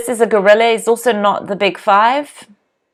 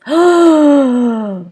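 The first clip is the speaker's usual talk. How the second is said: long, higher gasp; horrified gasp; 5 semitones higher than usual. Real, not acted. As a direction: suck in breath in horror. Horrified by room of hunting trophies.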